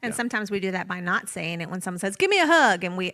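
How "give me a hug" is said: high, loud call for a hug; pitched up and pushed out loud; eager hug request. Acted. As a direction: act out a gushy relative wanting a hug, loudly. Acting someone demanding a hug.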